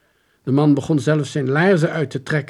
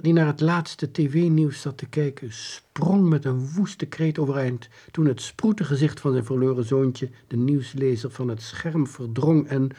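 ordinary delivery

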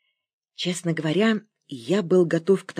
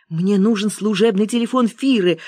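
raised, mildly eager request; gladdened; 4 semitones higher, 4 dB louder than usual. A narrator's reading. Caller glad to reach someone, a touch of eagerness. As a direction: lift the pitch with some relief. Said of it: "обрадовался я".

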